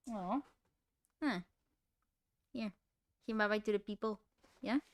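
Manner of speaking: quiet doggy talk. Real, not acted. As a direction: coo it to the dog. Talking to pet dog.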